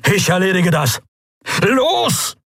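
high, urgent command; calling out. A narrator's reading. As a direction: send your comrade off while you fight. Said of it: "rief er Kai zu".

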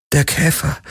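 high, fearful utterance; name the thing in alarm; frightened. A narrator's reading. Kirai tells Garland what she saw, frightened. Frightened woman reports dream creature.

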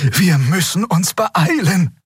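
high, slow urgent warning; urging. A narrator's reading. Xea clings to Keru's arm, urging. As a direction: urge them on in a high, drawn-out voice. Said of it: "mahnte Xea".

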